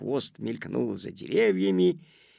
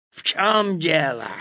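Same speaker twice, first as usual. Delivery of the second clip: a touch of menace, louder; angry demand; 3 semitones higher, 5 dB louder than usual. A narrator's reading. Wolf questions Petya who stopped him, angry demand. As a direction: snap it as a cross wolf. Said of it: "злобно спросил он".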